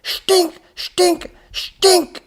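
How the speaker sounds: very high screams, word by word; furious scream. A narrator's reading. Professor drumming his name into robot, furious screaming. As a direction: scream each repeat at full force. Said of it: "screamed the professor".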